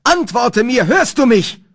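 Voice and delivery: high, loud calling into a phone; frantic bellowed call; desperate shouting. A narrator's reading. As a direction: yell for an answer. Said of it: "rief, schrie und brüllte ich".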